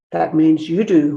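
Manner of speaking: ordinary delivery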